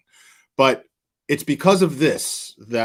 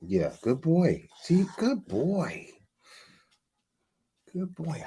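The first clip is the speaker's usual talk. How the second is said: slow, soothing praise for the dog; calm the excited dog with praise; 5 semitones higher, slower than usual. Real, not acted.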